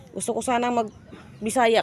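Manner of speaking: ordinary talk, nothing marked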